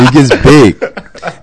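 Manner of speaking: real laughter running through the words; laughing. Real, not acted. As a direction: stretch it out while cracking up. Repeats the phrase amid laughter.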